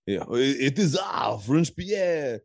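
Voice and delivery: hammed-up French attorney sales pitch; French Pierre. Podcast host playing an attorney selling lawsuits, comic French accent. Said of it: "an attorney called French Pierre".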